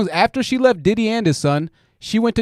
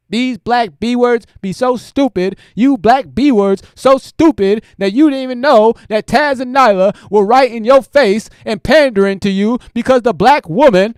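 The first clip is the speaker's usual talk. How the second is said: a loud, raised-pitch parody of his rant; imitating a ranting streamer; 5 semitones higher, 6 dB louder than usual. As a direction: bellow it like the guy going off on women. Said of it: "That's how he talks".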